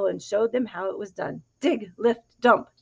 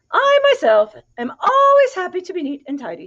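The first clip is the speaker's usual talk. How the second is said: much higher and louder, sing-song and proud; proud hen; 9 semitones higher, 7 dB louder than usual. A narrator's reading. Hen boasts to the pigs about tidiness, high and showy. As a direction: preen like a vain hen. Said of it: "said the hen".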